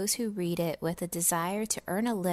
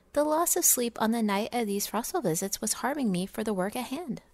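usual speaking voice

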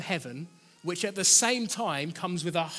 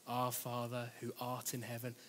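lower, much softer, monotone recitation; droning rote prayer; 5 semitones lower, 11 dB quieter than usual. Retold or imitated. Preacher mocking how congregations drone the Lord's Prayer, flat drone. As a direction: rattle it off like a bored rote prayer. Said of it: "almost prayer that's a little bit of a drone".

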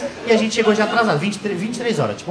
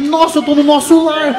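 mock awe; 6 semitones higher, slower than usual. Awed realization, slow.

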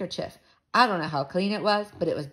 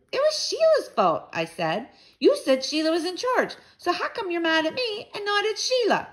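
put-on high-pitched boyish complaint; indignant protest; 11 semitones higher than usual. A narrator's reading. Boy protesting to mother that girl was in charge, indignant. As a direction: argue back hotly, pitched up.

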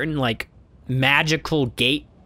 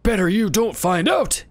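put-on dark old beggar; 6 semitones higher than usual. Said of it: "he replies darkly".

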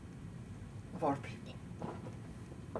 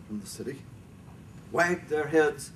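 ordinary delivery, nothing marked

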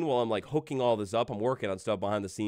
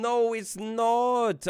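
drawn-out mock denial; 10 semitones higher, much slower than usual. Arguing with his own computer.

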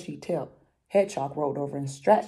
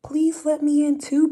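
much higher, louder pleading; begging hedgehog; 10 semitones higher, 7 dB louder than usual. Acted. Hedgehog begs the others, high and loud. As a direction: beg sweetly to get in. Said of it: "begged Hedgehog".

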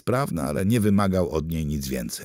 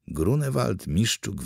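usual speaking voice

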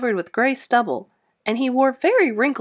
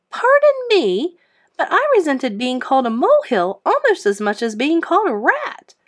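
offended hamster; 5 semitones higher, 4 dB louder than usual. A narrator's reading. Hamster bristling at being called a rat. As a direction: protest it with ruffled dignity.

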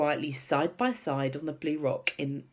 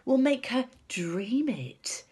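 high and eager, drawing out the last words; high, excited unveiling of a plan; excitedly; 11 semitones higher, 4 dB louder, slower than usual. A narrator's reading. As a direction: savour the big reveal, thrilled. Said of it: "Sophie said excitedly".

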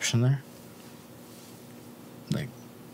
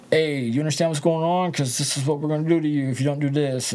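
higher-pitched, insistent mock intimidation; acted-out threat; 5 semitones higher than usual. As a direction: lay down the threat like the guys who cornered you. Acting out a gang's threat.

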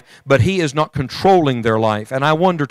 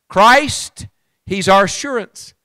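ringing revival sermon voice; full-force preaching; 3 semitones higher, much slower than usual. Acted. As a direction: lift the words out over the congregation, slowly. Preacher exhorting the congregation.

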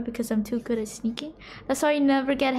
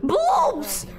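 shrill outcry; 11 semitones higher than usual. Real, not acted. Sudden outcry at screen.

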